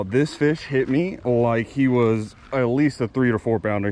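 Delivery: ordinary talk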